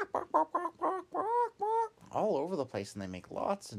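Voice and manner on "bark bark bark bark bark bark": high loud squawking bawk; chicken squawks. Retold or imitated. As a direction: bawk like frightened hens. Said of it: "they just go".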